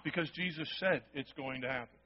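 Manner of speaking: low, quiet and certain. Real, not acted. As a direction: drop the voice and settle it. Drops low after the buildup.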